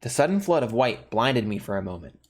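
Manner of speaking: steady, low-key horror narration; calm storyteller reading a horror novel; story narration. A narrator's reading. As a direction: read it like a creepy tale, measured. Reads the horror game's story.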